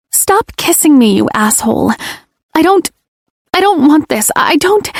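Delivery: high, pleading protest; desperate protest. Acted. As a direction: cry out, half begging. Heroine protests under him.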